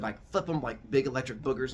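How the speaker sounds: ordinary talk, nothing marked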